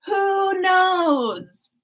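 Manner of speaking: wistful mock-mysterious repeat; musing repeat. Shown as a demonstration. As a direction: let it trail off mysteriously.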